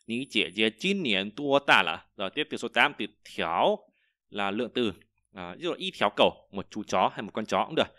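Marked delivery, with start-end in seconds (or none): 0.1-2.1 slow, careful Chinese question; slow teacher model; slow model reading. Shown as a demonstration. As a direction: read it slowly for students. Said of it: "Chị gái bạn năm nay bao nhiêu tuổi?".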